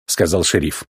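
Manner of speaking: plain reading voice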